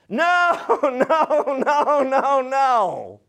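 high mock parent shooting down a request, half laughing; long high NO tumbling into a laughing run; emphatic parental refusal. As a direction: refuse like an exasperated parent, hammed up. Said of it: "Like, there's emphasis here".

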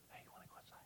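breathy whispered invitation. Retold or imitated. Inviting a friend outside, unvoiced whisper. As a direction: whisper it close to the ear. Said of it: "Sometimes God whispers".

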